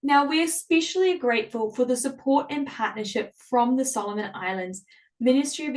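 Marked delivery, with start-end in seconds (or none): none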